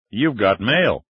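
electronic announcer voice; like an email alert voice; computer voice. Acted. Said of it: "the electronic voice announced".

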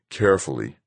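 ordinary delivery

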